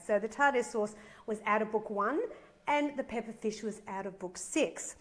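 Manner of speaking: ordinary talk, nothing marked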